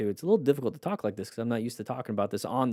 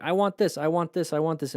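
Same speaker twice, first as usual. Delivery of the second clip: put-on high begging kid voice, higher pitch; whiny child; 6 semitones higher than usual. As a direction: beg like a child who wants a toy. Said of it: "a child's like".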